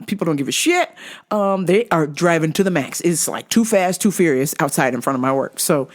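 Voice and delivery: normal talking voice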